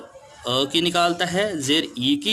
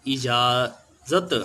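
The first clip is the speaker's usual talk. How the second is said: slow drawn-out word; 2 semitones lower, much slower than usual. Shown as a demonstration. Teacher reading out a word to children, slowly. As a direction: stretch इजाज़त slowly, syllable by syllable. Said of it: "जे ते जत".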